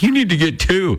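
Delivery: put-on kids demanding. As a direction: pitch it up like a kid insisting. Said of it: "They're like".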